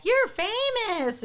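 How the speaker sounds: high-pitched, drawn-out mock yell; imitated boy's yell. Imitating a young boy yelling at Zach, high yell. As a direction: call it out high and slow. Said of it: "one of the young boys yells".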